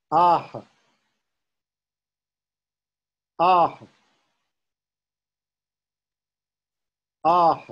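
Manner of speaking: "Ah" sent out with an echoing puff of breath. Shown as a demonstration. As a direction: chant "Ah", then let the breath follow. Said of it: "emphasizing the sending out of breath".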